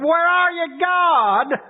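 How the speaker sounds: high, pleading call; a desperate voice straining upward; anguished cry to God. Acted. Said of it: "He's in distress".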